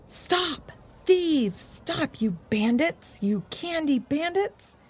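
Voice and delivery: high far-off calls after thieves; high hammed-up calls of stop, thieves; dogs yelling. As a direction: shout after the bandits in a high voice. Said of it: "heard voices from down the road yell".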